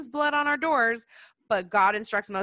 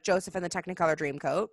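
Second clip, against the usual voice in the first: usual speaking voice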